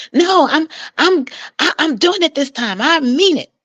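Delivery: high, emphatic mock insistence; insistent. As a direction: play her digging in her heels. Client insisting at the courthouse.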